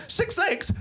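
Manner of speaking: high-pitched amazed exclaiming; hammed-up astonishment; shocked discovery. Horrified discovery of mutated spider.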